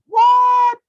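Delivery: a sudden high shout of What; outraged disbelief. Real, not acted. Reacting live to a headline for his viewers, shocked shouting. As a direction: yell it like you can't believe your eyes.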